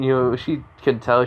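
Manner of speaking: usual speaking voice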